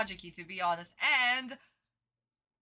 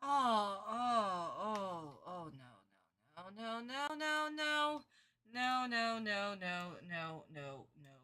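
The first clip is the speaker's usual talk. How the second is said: mock despair; 6 semitones lower, 8 dB quieter, slower than usual. Real, not acted. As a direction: fake sobbing oh no, fading to nearly nothing. Reacting in despair to dub.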